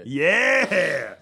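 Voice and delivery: big whooping yeah; triumphant cheer. Real, not acted. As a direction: holler it to celebrate the segue. Cheers for segue into next segment.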